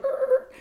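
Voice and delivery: put-on high-pitched yelp of a startled kid. Little brother jolted by the sudden jump-in, high yelp. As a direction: yelp like a ten-year-old caught off guard. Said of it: "He hella appeals, like".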